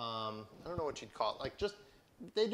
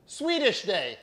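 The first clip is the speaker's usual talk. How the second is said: imitating wife; 7 semitones higher, 8 dB louder, slower than usual. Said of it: "She goes".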